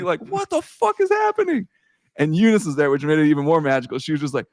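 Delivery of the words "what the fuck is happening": yelling at the TV. Retold or imitated. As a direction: scream it at the screen. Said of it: "I'm screaming at the T V".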